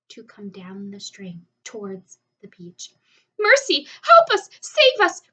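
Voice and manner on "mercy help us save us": high, loud panicked scream for help; terrified cry. A narrator's reading. Ladybug panics at the cloudman, screaming for help. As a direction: cry out in terror as the monster comes. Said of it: "cried the ladybug".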